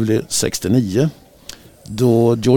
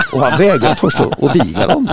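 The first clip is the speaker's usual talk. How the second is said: higher, talking through real laughter; amused, voice lifted by laughter; laughing; 13 semitones higher than usual. Real, not acted. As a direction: laugh your way through the punchline. Laughing at own anecdote's punchline.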